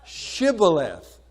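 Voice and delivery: Shibboleth said slowly with a clear sh; Shibboleth, sh at the start, drawn out. Shown as a demonstration. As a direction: spell out Shibboleth syllable by syllable. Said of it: "Remember the word that he used?".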